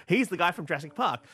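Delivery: normal talking voice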